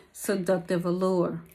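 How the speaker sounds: ordinary talk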